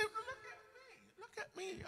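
very high, far-off squeal of look at me; a hammed-up high pleading voice. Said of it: "you say".

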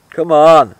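loud, real cheer; triumphant shout. Real, not acted. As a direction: shout it out in celebration. Celebrating his own beer.

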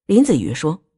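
usual speaking voice

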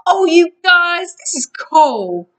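slow, loud, delighted drawl from a high start. A narrator's reading. Grover to friends from the massage bed, delighted. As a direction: savour it loudly and slowly.

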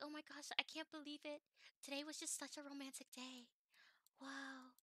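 high, dreamy put-on voice; swooning girlfriend. Girlfriend marvelling at such a romantic day, swooning.

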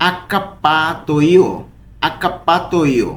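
আক্কাপ্পাতোইও spoken very slowly, each syllable separate, double consonants held; slow pronunciation demo. Shown as a demonstration. Teacher modelling a word for students, slow and careful. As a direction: sound out আক্কাপ্পাতোইও syllable by syllable for learners. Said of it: "বারবার করে উচ্চারণ করার চেষ্টা করব".

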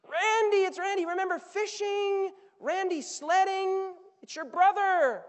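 high, loud and slowed, coaxing; pleading servant. Acted. Servant pleading with the brother outside. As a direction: coax him with memories, loud and high.

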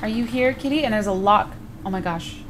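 ordinary delivery

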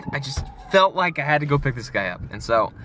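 ordinary talk

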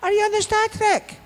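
very high, loud falsetto mum; imitated mother. Mum asking son on phone, high falsetto. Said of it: "My mum comes on the phone".

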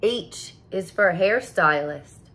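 usual speaking voice

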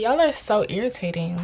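ordinary talk, nothing marked

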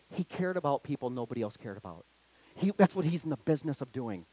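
soft, low, intimate reassurance; tender appeal. Acted. Pastor comforting lonely listeners, soft and low. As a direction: speak it softly to one hurting person.

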